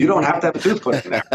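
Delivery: amused, quick reassurance; laughing. Real, not acted. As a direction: chuckle it out while reassuring. Reassuring patient, listener laughs.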